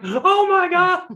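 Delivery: high squealing panicked cry; retold ride panic, squealed high; panicked friend. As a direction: squeal it like he's lifting out of his seat.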